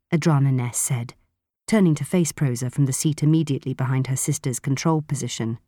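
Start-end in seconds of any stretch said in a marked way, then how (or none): none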